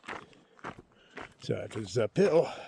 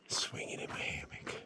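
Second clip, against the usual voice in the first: soft whisper; 8 dB quieter than usual. Real, not acted. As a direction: breathe it out dreamily. Dreamy whisper about hammock.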